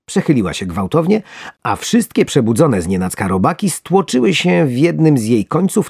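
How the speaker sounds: plain reading voice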